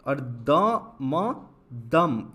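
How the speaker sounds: द, म, then दम, very slow; spelled out slowly. Shown as a demonstration. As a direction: build दम sound by sound. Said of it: "इसका sound आया द".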